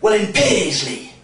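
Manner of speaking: slow, emphatic mock Scottish retort; Scottish mum. Scottish mum answers the German guy, drawn-out mock Scottish comeback. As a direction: fire back in a put-on Scottish accent. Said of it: "she looked back without missing a beat and went".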